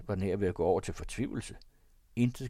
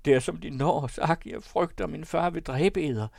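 high, raised, fearful voice; alarmed cry; 8 semitones higher, 5 dB louder than usual. A narrator's reading. Woman fearing her father will kill her lover, crying out. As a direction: plead in alarm, pitch climbing high. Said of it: "råbte hun".

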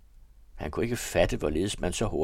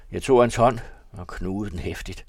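plain reading voice

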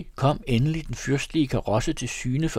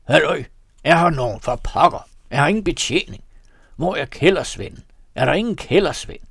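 loud impatient calls, pitched up; shouting for service; 5 semitones higher, 6 dB louder than usual. A narrator's reading. Man in empty cellar calling for service, loud and impatient. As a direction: bellow for the cellar boy. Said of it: "Pludselig råbte han".

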